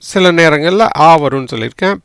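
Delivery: usual speaking voice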